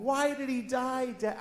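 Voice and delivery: put-on a sad little kid's question; child's voice. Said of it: "my daughter comes home".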